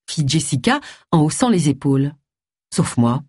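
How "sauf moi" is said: low, bitter murmur to herself; a touch of resentment, pitched low. A narrator's reading. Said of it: "songea Agatha avec amertume".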